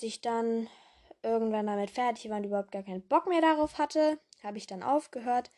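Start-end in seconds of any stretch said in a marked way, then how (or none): none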